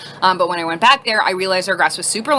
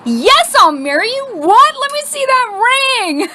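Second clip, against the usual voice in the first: high, loud, hammed-up squeal; ecstatic acceptance; 14 semitones higher, 5 dB louder, slower than usual. Girlfriend accepting a sudden proposal, squealing excitedly. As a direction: squeal it, stretching the end.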